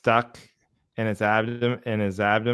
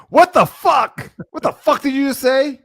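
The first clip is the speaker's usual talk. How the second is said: much higher, louder hammed-up rage; joking over-the-top parental outburst; mock furious parent; 12 semitones higher, 6 dB louder than usual. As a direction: pretend to blow up at the kid. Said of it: "you just come in, you're like".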